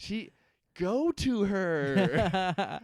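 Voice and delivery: very slow, high, loud drawn-out plea; mock earnest. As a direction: stretch it out like a soap opera. [name] bit.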